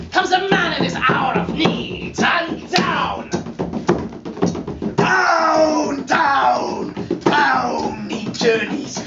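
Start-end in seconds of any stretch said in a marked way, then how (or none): none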